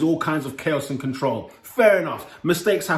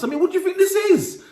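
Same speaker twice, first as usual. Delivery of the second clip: much higher, disbelieving squeal; incredulous. Real, not acted. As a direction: ask it as if you can't believe your eyes. Outraged at driver's behaviour.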